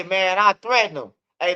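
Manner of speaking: ordinary delivery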